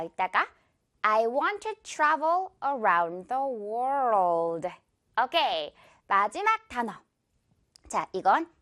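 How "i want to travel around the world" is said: very slow, clear word by word; slow repeat. Shown as a demonstration. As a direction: slowly model each word. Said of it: "이 문장 한번 해볼게요". (천천히 따라 말하기)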